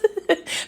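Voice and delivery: a short high laugh at her own joke; bursting into a giggle over Zeke in jail; high burst of laughter. Real, not acted. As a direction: let a quick high giggle escape.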